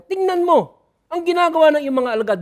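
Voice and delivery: angry. Acted. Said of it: "Galit na sila kay Jesus".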